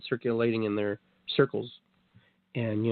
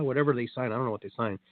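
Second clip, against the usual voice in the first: ordinary talk, nothing marked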